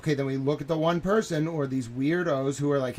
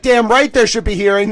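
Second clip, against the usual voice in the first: higher and louder, hot indignation; loud angry retort; 6 semitones higher, 9 dB louder than usual. Retold or imitated. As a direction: slam it back at him. Angry retort on phone call.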